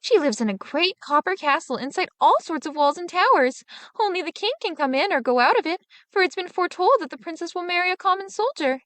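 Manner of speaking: usual speaking voice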